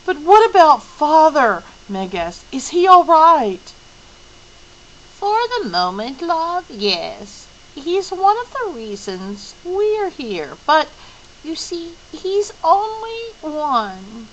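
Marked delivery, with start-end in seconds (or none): 0.1-1.6 anxious plea. Acted. Meg anxiously asks about father. 2.5-3.7 anxious plea. Acted. Meg anxiously asks about father. 5.2-14.3 put-on higher, lilting old lady voice; a gentle old woman soothing a child; hint of a creaky old lady; kindly old Mrs Whatsit.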